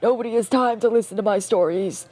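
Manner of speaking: put-on a self-pitying old veteran rambling; very old man. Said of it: "let me get my old man voice".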